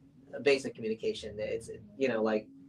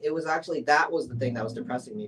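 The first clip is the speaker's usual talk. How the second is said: usual speaking voice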